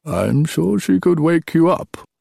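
put-on high, slow, teasing old king; old king joking. A narrator's reading. Old king teasing his tired son, joking. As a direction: tease him with a drawn-out lilt. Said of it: "Peleus chuckled, as if this were a joke".

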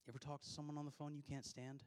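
ordinary talk

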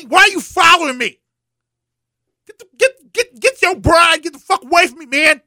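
high, loud, squawking mock Queen telling everyone off; mock Queen yelling. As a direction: play the Queen shrieking at Princess Anne to get him away from her. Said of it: "she was looking at Princess Anne like".

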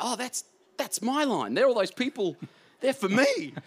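high, excited disbelief at his own line; a giddy, wide-eyed rush of joy; amazed delight. Retold or imitated.

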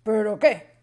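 sharp loud surprised question; the king startled, demanding. Acted. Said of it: "se sorprendió el rey".

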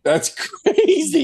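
high, laughing "That's crazy"; laughing disbelief. Real, not acted. Reacting to Stallone's age.

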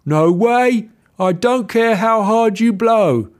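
high squeaky pig voice, loud and firm; little pig standing its ground; defiant little pig. Acted. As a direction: Maxie refusing the wolf, high and loud. Pig Maxie refusing wolf.

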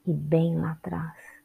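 unhurried meditation guide with long pauses; calm instructions in short, spaced-out phrases; guided meditation. Real, not acted. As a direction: guide them through the body, leaving space after each instruction.

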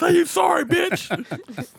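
loud, high mock shout through laughter; mock demand. Insulting a guy until he apologizes, mock angry shout.

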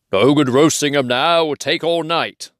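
gruff troll voice. Acted. Hidden voice mimics a troll.

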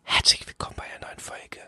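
breathy unvoiced whisper imitation; ASMR whisper. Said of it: "sie macht immer so".

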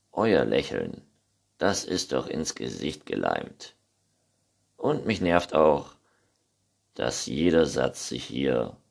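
low, annoyed grumble; sulky low complaint; grumpy Neinhorn. A narrator's reading. (mürrisch tiefe Stimme)

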